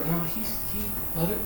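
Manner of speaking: hushed, broken-up reminder to let go; calming self-talk. Retold or imitated. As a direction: talk yourself down quietly. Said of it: "then I have to remember".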